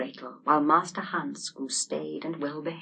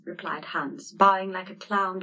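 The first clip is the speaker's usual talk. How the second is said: plain reading voice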